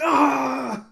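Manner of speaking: high, loud acted-out cry; sudden hammed-up wail; mock scream. Girl powers up after being insulted, mock scream. Retold enraged girl cries out.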